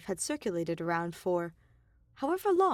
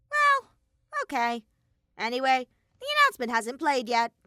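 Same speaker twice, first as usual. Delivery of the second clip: high, loud cartoon bear voice; louder, higher bratty bear mascot; Monokuma voice; 5 semitones higher, 7 dB louder than usual.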